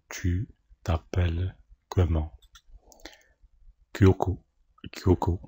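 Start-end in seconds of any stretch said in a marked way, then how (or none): none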